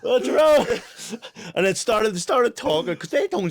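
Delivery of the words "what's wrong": high, loud, startled voice; imitating Greedo. Greedo cutting in on the video call, high loud startled voice. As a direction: play the shocked friend. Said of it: "Greedo was like".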